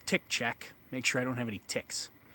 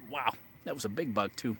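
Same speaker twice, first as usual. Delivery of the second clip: just inhaled a bug. Real, not acted. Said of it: "I just inhaled a bug".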